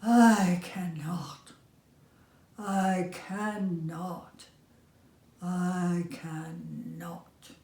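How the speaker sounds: put-on old engine chugging away from the toys, fading out; tired old engine, fading. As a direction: let the refusal die off in a slow chug. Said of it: "off he rumbled to the roundhouse chugging".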